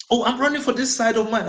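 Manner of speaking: high, fast mock voice of the politician. To the narrator, a politician dodging in a high mock voice. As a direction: squeak out his lame answer like a dodgy politician.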